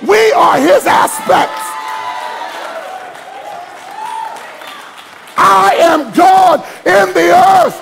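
fiery pulpit shouting; preaching at full force. Acted. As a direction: build the congregation to a roar.

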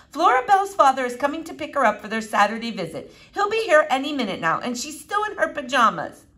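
lightly flustered parent's voice; flustered mother. Acted.

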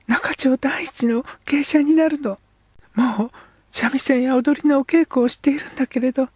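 put-on high young girl's voice, eager and proud; a girl telling her dream of being a geisha. Girl character speaks.

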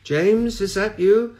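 high, thin, far-off voice like a fading mist; distant ghostly voice. A narrator's reading. The dead brother calls to the priest, high and thin. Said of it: "the voice of the priest's brother ... fragile and tremulous, reedy and thin".

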